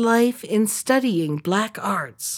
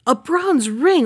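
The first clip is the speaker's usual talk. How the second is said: incredulous servant; 5 semitones higher, slower than usual. A narrator's reading. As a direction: throw A bronze ring back high and disbelieving. Servant scoffs at the price.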